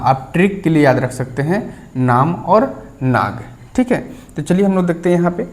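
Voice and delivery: ordinary talk, nothing marked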